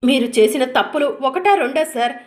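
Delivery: loud, scornful question; angry accusation. Acted. Wife accusing her husband, loud and scornful. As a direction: hurl his wrongs at him.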